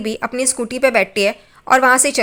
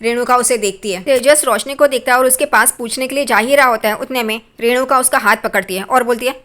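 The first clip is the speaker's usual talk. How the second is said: usual speaking voice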